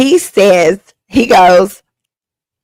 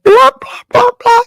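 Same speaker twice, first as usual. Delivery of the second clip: very high, louder dismissive babble; mocking blah blah; 4 dB louder than usual. Comic tuning out her date, high mocking babble. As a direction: wave him off with silly high babble.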